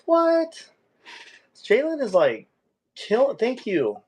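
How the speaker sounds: high, disbelieving delight; giddy high amazement; amazed squeal. Real, not acted. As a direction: gush with awe. Still marvelling at donation.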